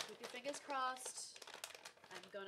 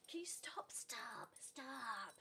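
hushed scolding; 9 dB quieter, much slower than usual. Real, not acted.

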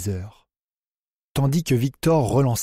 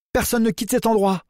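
shouted order; 10 semitones higher than usual. A narrator's reading. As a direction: bellow it to stop them all. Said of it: "hurla-t-elle".

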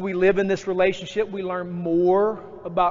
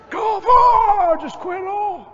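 despairing cry; 8 dB louder, slower than usual. Retold or imitated. Preacher voicing his own despair.

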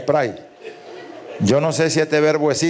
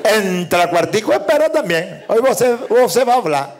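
pastor again, higher; 5 semitones higher than usual. Acted. Pastor manda al cuartico.

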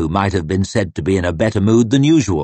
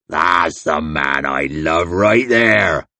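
slow, lower, booming hillman voice; hearty hillman shout; 4 semitones lower, slower than usual. Acted. Crummock cheers Logan's insult, slow booming shout. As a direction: bellow it with a big grin.

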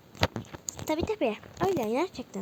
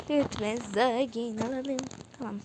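ordinary delivery

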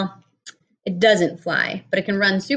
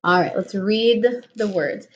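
ordinary talk, nothing marked

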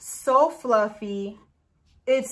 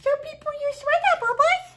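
dog mom voice; 4 dB louder than usual. Real, not acted. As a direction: coo at your puppy in a much higher voice. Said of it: "I definitely developed a dog mom voice".

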